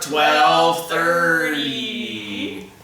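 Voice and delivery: very slow, mechanical alien drone; robot alien voice. Pretend. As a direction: chant it like a robot from space. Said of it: "how about we do it in a robot alien voice".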